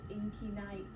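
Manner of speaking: ordinary delivery, nothing marked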